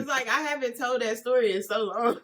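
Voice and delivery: words carried on laughter; cracking up. Real, not acted. Friend who hasn't told the story in so long, cracking up. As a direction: laugh while excusing the giggles. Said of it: "I'm laughing now".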